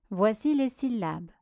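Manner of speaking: ordinary delivery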